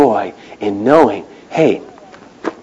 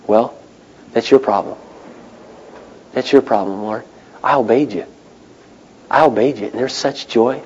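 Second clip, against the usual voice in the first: quiet prayer; 2 semitones lower, 8 dB quieter than usual. Retold or imitated. Retold prayer to God.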